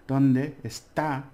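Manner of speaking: "donde está" said very slowly and clearly; slow model phrase. Shown as a demonstration. Teacher modelling "donde está" slowly for learners. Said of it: "and remember the".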